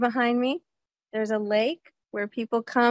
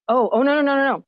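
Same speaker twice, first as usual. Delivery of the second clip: louder run of no, no, no; emphatic denial; 3 semitones higher, 5 dB louder, faster than usual. Real, not acted. To her viewers, brushing off the myth with a loud no. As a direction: shut the myth down firmly. Rejecting a myth about kids.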